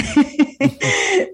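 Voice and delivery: a burst of real laughter, rising; happy laugh; bright laugh. Real, not acted. Laughing at her own turnaround.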